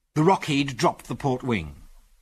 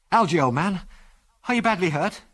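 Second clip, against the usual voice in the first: high, urgent worried question; anxious concern; 7 semitones higher than usual. A narrator's reading. Ginger reaching Algy after the crash, anxious and urgent. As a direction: ask with alarm, pitch raised.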